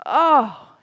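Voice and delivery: lightly voiced frog's big intake before boasting; excited oh. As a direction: gasp out the oh, ready to brag. Ocean frog about to boast.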